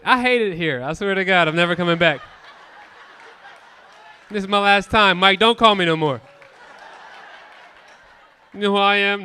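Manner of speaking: loud hammed-up complaint; mock tantrum. Comic fake-storming off at the audience, loud mock anger. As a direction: play the wounded star.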